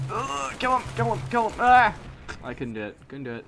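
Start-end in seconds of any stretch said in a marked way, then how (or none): 0.1-1.9 high, loud frantic yell; frantic urging. Real, not acted. Gamer yelling at himself mid firefight, frantic. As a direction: scramble to get the kill, desperate.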